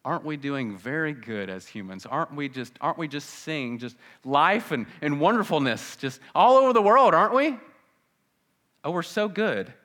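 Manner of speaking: mocking praise building to a loud high peak; scoff, rising into loud ironic praise; sarcastic.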